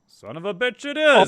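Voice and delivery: high-pitched acted exclamation, ending loud; hammed-up astonishment, leaping up in pitch; astonished outburst. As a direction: exclaim it like an amazed discovery. Advisor acted finding the lost folder.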